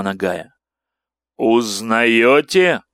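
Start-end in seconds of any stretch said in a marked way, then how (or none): 1.4-2.8 slow, loud monstrous question; inhuman voice. Acted. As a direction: drag it out like a monster. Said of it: "спросил нечеловеческий голос".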